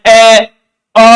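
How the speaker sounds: ए and अ drawn out long, held steady; held vowel. Shown as a demonstration. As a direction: stretch ए and अ without a break. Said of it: "लगातार किया जाता है". (स्वर को लंबा खींचकर बोलना)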